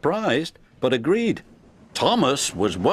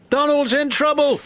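high, raised-voice alert; urgent alarm; 12 semitones higher, 4 dB louder than usual. A narrator's reading. Duck steams in to Douglas for help, urgent.

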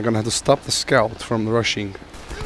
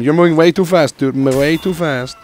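higher, louder exasperated yell; frustrated shout; 5 semitones higher, 6 dB louder than usual. Real, not acted. Gamer chasing a runner, exasperated yell. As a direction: shout at the fast enemy.

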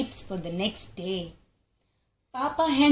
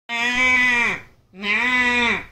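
loud bleating; goat bleat; 4 dB louder than usual. Acted. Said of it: "bleats goat".

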